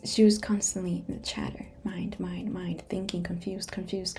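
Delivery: ordinary delivery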